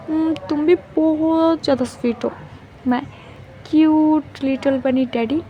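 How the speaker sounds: put-on light, affectionate baby-talk lilt; sweet cooing partner. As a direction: coo fondly at a sweetheart.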